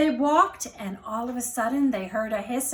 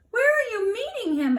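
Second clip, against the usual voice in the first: higher, curious owl asking; a lightly voiced owl, higher and curious; 8 semitones higher, faster than usual. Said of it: "asked the owl".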